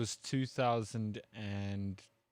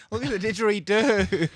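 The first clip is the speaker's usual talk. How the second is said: higher and louder Aussie voice through laughter; laughing mock Aussie; 9 semitones higher, 8 dB louder than usual. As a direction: shout it like a laughing Aussie tourist. Mocking Australians, laughing.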